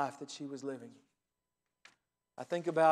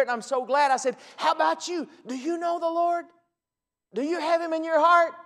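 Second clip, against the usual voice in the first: preaching; 5 semitones higher, 5 dB louder than usual. Acted. Pastor preaching the gospel to a scam caller, building preach. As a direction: preach the gospel at him with rising fervour.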